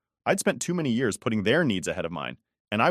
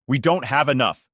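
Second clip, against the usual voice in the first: louder, sharp angry retort; snapped; 5 semitones higher, 6 dB louder than usual. A narrator's reading. Dad on the phone snapping at Helen, angry and louder. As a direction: snap back at her in anger. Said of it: "he snapped".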